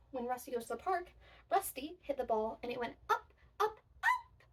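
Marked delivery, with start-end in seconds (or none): 3.1-4.4 rising high. Acted. Acting out ball going up.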